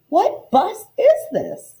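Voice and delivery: high, slow, wary asking; a puzzled girl on a strange bus; nervous question. Acted. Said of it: "I asked".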